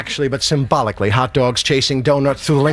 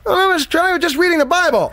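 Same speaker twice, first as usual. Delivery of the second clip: fake-awake denial; faster than usual. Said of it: "I didn't wake you, did I".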